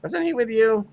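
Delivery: high-pitched neighbor voice; squeaky character voice; high put-on voice.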